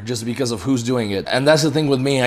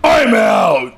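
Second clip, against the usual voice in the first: higher, louder outro exclamation; big sign-off shout; 10 semitones higher, 6 dB louder than usual. Real, not acted. To viewers, ending the video with a loud excited sign-off. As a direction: throw the sign-off out with energy.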